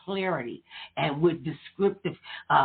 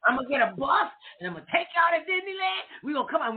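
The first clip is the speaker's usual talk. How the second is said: mock scheming guest; 8 semitones higher, faster than usual. Mocking the host's bus plan.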